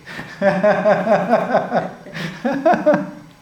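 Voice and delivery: a long hearty laugh at his own joke. Real, not acted. Teacher laughing after the Australia accent story, hearty laugh. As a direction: burst out laughing, full and free.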